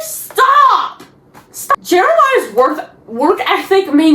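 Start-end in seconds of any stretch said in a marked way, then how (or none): 0.0-1.8 high, drawn-out exasperated protest. Retold or imitated. Vlogger reacting to Jeremiah's bad timing, exasperated high cry. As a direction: plead in mock outrage for him to quit.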